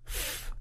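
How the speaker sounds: "f" as pure hissed breath, no voice; voiceless consonant demo. Shown as a demonstration. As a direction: blow a soft, isolated "f" through lip and teeth. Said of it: "This is a voiceless sound".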